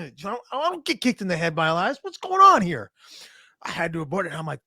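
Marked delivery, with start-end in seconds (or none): 0.0-2.9 shocked disbelief. Retold or imitated. Shock at getting kicked.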